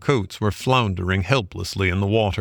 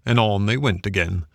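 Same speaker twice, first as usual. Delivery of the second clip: ordinary delivery, nothing marked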